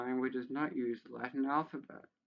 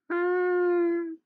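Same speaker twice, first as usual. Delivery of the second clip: mock squeal; 9 dB louder than usual. Mocking the guide's odd instruction.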